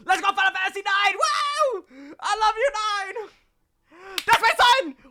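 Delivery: high, loud fangirl scream; excited screaming. Real, not acted. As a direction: scream with pure joy. Screaming at favourite game trailer.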